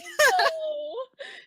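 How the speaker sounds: high shrieking laugh. Real, not acted. Reader laughs hysterically at the flubbed line. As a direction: laugh till it squeals.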